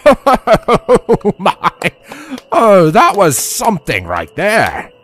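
loud, high theatrical laughter into speech; chuckling. Acted. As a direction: laugh through it in amused delight. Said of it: "He chuckled gently to himself".

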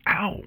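sudden high "Ow!"; yelp of pain. Acted. Stung on the ear mid-introduction, pained high yelp. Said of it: "Something stung the back of her ear".

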